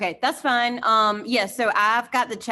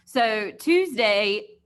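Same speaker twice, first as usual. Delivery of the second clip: Tuesday drawn out slowly and louder; stress on Tuesday; 6 dB louder, much slower than usual. Shown as a demonstration. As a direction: lean hard on Tuesday. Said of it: "Remember, Mondays are virtual days".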